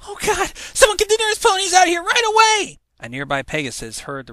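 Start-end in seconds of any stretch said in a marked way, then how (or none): 0.1-2.8 high, loud shocked cry for help; gasping alarm. Acted. A pony seeing the bakery scene, shouting for nurses. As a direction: gasp and call out urgently.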